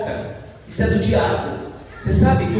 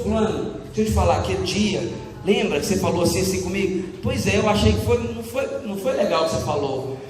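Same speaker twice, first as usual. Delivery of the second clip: softer, lower, careful voice; gentle confrontation; 3 semitones lower, 4 dB quieter than usual. Shown as a demonstration. Offended person privately approaching someone, gentle. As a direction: raise the grievance quietly and without attack.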